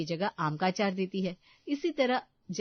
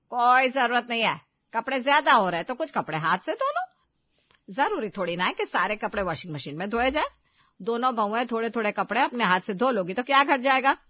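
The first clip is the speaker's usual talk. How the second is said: louder, stern and dismissive; loud stern refusal; 2 semitones higher, 7 dB louder than usual. Acted. Mother-in-law refuses a new washing machine, loud and stern. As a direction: shut the idea down loudly.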